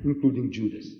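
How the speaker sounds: ordinary talk, nothing marked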